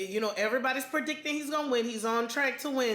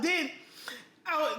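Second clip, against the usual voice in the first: ordinary delivery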